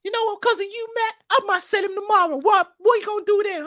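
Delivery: normal talking voice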